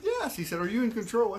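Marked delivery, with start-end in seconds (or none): 0.0-0.4 very high, insistent yes; acted exasperation under questioning; exasperated yes. Acted escalating interrogation. 0.6-1.3 high, insistent questioning; acted interrogation, pitch raised; pressing question. Friend presses him with questions.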